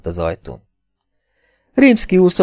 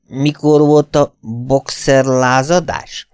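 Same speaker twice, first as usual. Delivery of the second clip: slow, higher-pitched befuddled question; befuddled drunk; 5 semitones higher, slower than usual. A narrator's reading. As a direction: puzzle it out drunkenly, dragging words. Drunk Rimszky trying to work out time. (lassú, részeg töprengés)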